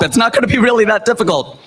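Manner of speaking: ordinary talk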